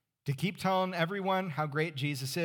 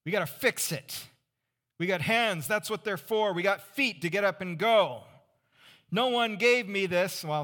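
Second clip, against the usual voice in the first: louder and higher, rallying the congregation; preaching at full force; 7 semitones higher, 6 dB louder than usual. Acted. Preacher rallying his church with the fix-it creed, loud and rising. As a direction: drive home the can-do creed with mounting force.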